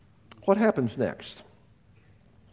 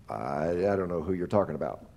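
much lower and softer, shrinking away; nervous denial; 11 semitones lower, 6 dB quieter than usual. Acted. Peter lies to the girl, low and furtive. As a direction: mumble the lie to avoid being caught. Peter denies knowing Jesus.

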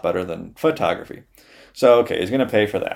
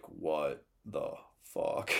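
stunned slow disbelief; 7 semitones lower, 16 dB quieter, much slower than usual. Retold or imitated. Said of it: "and the studio is like".

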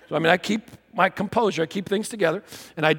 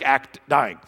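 ordinary delivery

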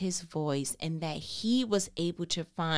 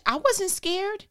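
ordinary delivery

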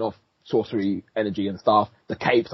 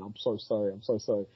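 softer, lightly imitated tearful apology; mock crying; 3 semitones lower, 9 dB quieter than usual. Retelling Doctor Strange apologising to Christine, softly mock-tearful. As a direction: retell a broken apology, understated and quiet. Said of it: "like, crying".

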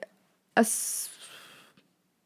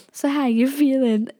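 higher-pitched, lightly teasing imitation; mock taunting; 5 semitones higher, 3 dB louder than usual. Friend goes up behind Ella to gloat, mock taunting. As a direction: imitate a friend needling someone.

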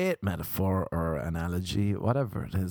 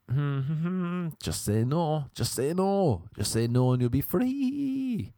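high sing-song urging, last word stretched; pleading inner voice; 6 semitones higher, slower than usual. Acted. Inner voice urging him to say no, high sing-song plea. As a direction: coax yourself in a high, drawn-out plea.

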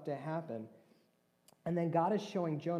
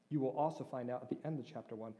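usual speaking voice

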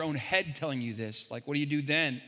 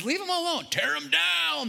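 much higher, whiny official voice; mock the contradictory city; 10 semitones higher, 3 dB louder than usual. Pastor mocks evil city people's orders, higher. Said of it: "the evil people of the city said".

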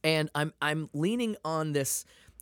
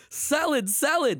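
higher, louder mock yell of "Salad, salad"; imitated yelling; 13 semitones higher, 4 dB louder, slower than usual. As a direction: bark the word like a confused angry man. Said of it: "Why are you yelling salad at me?".

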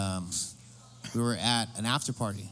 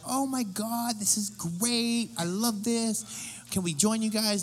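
high, gushing tourist voice; excited tourists; 7 semitones higher, slower than usual. Retold or imitated. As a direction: gush like a thrilled visitor. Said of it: "they're like".

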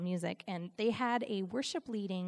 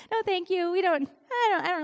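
loud, higher-pitched refusal; mock rejection; 8 semitones higher, 7 dB louder, faster than usual. Someone refusing her offered gift, emphatic mock rejection. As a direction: imitate an emphatic turn-down.

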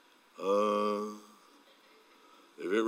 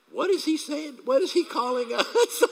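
much higher, confused mock question; bewildered retold double-take; baffled; 5 dB louder than usual. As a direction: play puzzled, voice jumping up high. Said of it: "And I'm like".

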